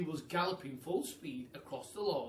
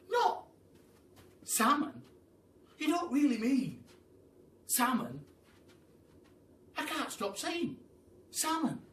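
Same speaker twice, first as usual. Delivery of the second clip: high, disbelieving and giddy; thrilled monkey; 11 semitones higher, 7 dB louder than usual. Acted. The monkey can't believe the Duke has walnuts, giddy. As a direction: gush over the word in delight. Pelican voice.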